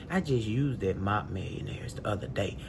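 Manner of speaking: low, soft, tossed-off remark; low aside. Real, not acted. Woman recalling her recent use of the mop, low aside. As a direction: drop it low and quiet, in passing. First take, said in passing.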